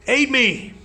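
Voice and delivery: high, loud call for help; desperate battle cry to Hodar; yell for aid. Acted. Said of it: "I yell".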